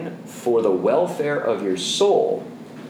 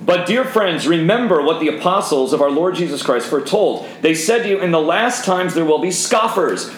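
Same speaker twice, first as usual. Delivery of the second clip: plain; 5 semitones higher than usual. Acted. Rereads the verse aloud.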